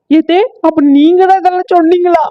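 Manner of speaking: high shocked accusing voice; shrill accusation; shocked wife. Acted. Wife confronts mother-in-law.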